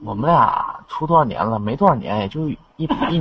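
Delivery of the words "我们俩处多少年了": ordinary delivery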